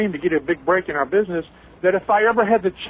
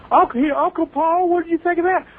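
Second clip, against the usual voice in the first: put-on eager young voice; 11 semitones higher than usual. Mick quoted asking Uncle Paul.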